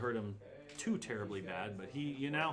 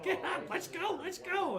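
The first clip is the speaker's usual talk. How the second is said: high-pitched goblin urging; urgent goblin; slower than usual. Acted. Goblin shaking his buddy, urgent high squeal. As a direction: squeal at your buddy to move.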